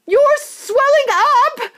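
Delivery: very high, slow, loud scream; mother screams again, drawn out. Acted. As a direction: stretch the scream out in horror. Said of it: "screamed Missus Beauregard again".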